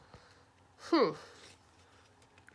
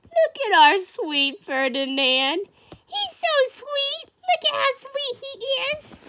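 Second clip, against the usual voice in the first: cooing; 11 semitones higher than usual. Acted. Teacher shows children sweet Ferdinand, high cooing. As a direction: gush over him in a tiny high voice. Cooing over the gentle bull.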